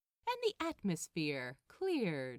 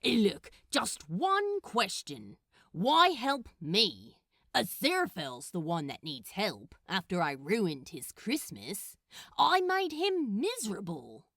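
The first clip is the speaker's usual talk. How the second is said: louder, pleading demon voice; a frustrated demon asking why; Crowley, exasperated; 6 dB louder than usual. Acted. Crowley questioning Gabriel about helping him.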